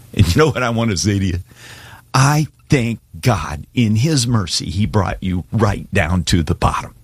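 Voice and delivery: ordinary talk, nothing marked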